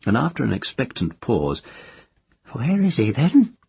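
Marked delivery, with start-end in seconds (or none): 2.5-3.6 put-on high, cracked old crone gossiping; nosy old village witch. A narrator's reading. The witch speaks.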